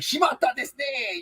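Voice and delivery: very high, put-on Japanese outburst; mock anime exclamation. Imagined anime player drops the prize, high mock dismay. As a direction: squeal it in a hammed-up anime voice. Acts out anime claw-machine failure.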